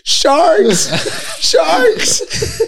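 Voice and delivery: mock terrified high shriek, breaking into laughter; panicked shriek. Friend laughing as he imitates Ginge's mock terrified shriek of sharks. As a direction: shriek it like he's sure there are sharks, laughing. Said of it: "he goes".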